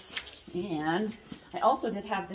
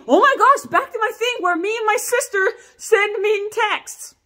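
much higher, louder gushing burst; excited; 13 semitones higher, 7 dB louder than usual. Real, not acted. Telling viewers about mean texts with her sister, excited. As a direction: burst out with delight.

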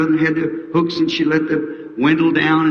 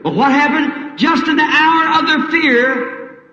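high, slow dramatic climax; rising climax; 10 semitones higher, slower than usual. Real, not acted. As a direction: lift it up slowly, high. Preacher climax.

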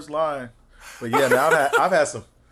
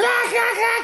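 high, exaggerated mock cackle; imitated laugh; 3 dB louder than usual. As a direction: mimic someone laughing too hard. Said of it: "You be like".

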